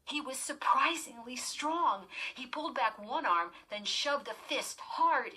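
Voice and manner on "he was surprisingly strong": ordinary delivery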